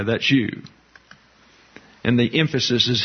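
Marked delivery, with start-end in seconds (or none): none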